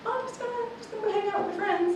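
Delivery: very high, soft, put-on innocent voice; squeaky little acted fib; fake-casual lie. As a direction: toss off a mock-innocent excuse, high and quick. Rehearsed lie to her mom.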